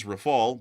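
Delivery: Rafale as ruh-FAHL; English pronunciation. Shown as a demonstration. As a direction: say Rafale with two syllables, stopping on L. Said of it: "The English pronunciation is".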